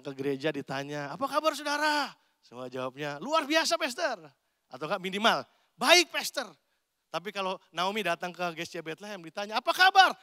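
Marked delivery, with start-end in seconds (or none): 1.1-2.1 cheery pastor greeting. Retold or imitated. 3.2-4.2 mock enthusiastic reply. Congregant's stock church answer. 5.8-6.5 loud dutiful reply. Retold or imitated. Congregant's stock answer. 9.6-10.1 high, loud call of hello; loud bright greeting. Retold or imitated. Pastor greeting Naomi at church, loud and bright.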